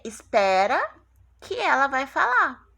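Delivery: "espera" loud and rising, then a pause before "que ela vai falar"; hold-on announcement. Shown as a demonstration. Teacher explaining the colon in a story, suspenseful announcing. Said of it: "Dois pontos".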